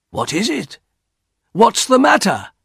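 high-pitched alarmed questions; startled cry. A narrator's reading. Husband woken by wife's cry, crying out in alarm.

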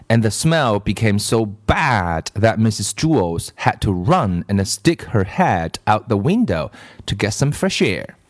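plain reading voice

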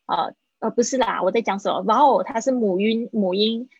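ordinary talk

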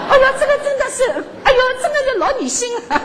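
high, quick, laughing outburst of amusement; amused at modern romance writing, exclaiming through laughter; laughing exclamation. Real, not acted. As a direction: laugh it out in disbelief.

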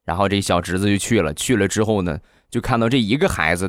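ordinary delivery

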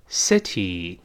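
"city" as "seh-tee", relaxed first vowel, clear final ee; short-i then ee. Shown as a demonstration. Teacher modelling city for students, relaxed first vowel and ee ending. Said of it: "中间发哎，但末尾发一".

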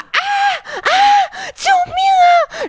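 high, drawn-out terrified cry; reenacted scream for help. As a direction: scream for help as if the crab has hold of you. (高声拖长的惊恐呼救)

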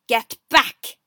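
loud, forceful order; angry warning. A narrator's reading. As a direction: bark it out to drive them away. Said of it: "he said angrily".

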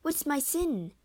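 high-pitched plea; begging. A narrator's reading. Desdemona begs to know her sin, high plea. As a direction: plead to be told, voice lifted. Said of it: "she begged".

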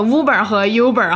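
first "Uber" as "woo-ber", second "Uber" as "you-ber"; two Uber pronunciations. Shown as a demonstration. Host recalls a similar muddle, "Uber" as "woo-ber" then "you-ber". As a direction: contrast "Uber" with oo against "Uber" with you. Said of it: "有人读的是vlog".

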